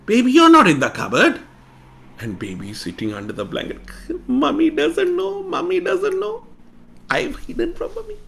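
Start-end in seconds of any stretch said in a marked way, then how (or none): 0.1-1.4 high, loud playful mock surprise; mother teasing the hiding child, high; mock search. 4.3-8.2 put-on very high little child voice; tickled to be hidden; child whispering to himself under the blanket, very high; gleeful child.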